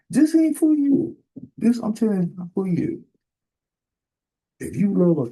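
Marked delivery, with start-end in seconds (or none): 0.1-3.0 emphatic warning. Real, not acted. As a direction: call it out like a warning. Preacher warns troublemakers.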